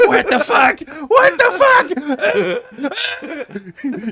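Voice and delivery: high shout of disbelief; mock outraged scream. [name] reacts to the lost title with a high, screamed what the fuck. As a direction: scream it like a betrayed fan.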